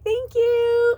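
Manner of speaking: very high, bright sing-song, loud; gushing, overjoyed squeal of love; joyful love you. Acted. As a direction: sing it out like you mean it with glee. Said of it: "with joy".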